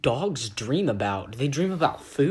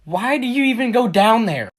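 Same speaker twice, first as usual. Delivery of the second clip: ordinary talk, nothing marked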